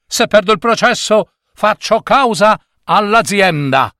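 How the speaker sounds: high, indignant warning; lawyer's shouted threat. A narrator's reading. Lawyer threatens to sue the bus company, indignant cry. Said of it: "gridò un avvocato".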